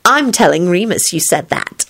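usual speaking voice

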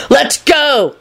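high, frantic holler; frenetic holler. A narrator's reading. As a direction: holler to get moving now. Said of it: "Lupin hollered frenetically".

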